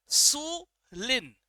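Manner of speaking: सु लिन slow, two clear separate words; separated syllables. Shown as a demonstration. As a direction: pause between सु and लिन so it is not insulin. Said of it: "इंसुलिन नहीं बोला मैंने".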